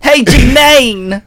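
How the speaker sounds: high, loud, slow drawn-out "Hey, Jermaine"; hammed-up cheery hello; mock greeting. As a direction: stretch the name out big and bright. Echoing the greeting loudly.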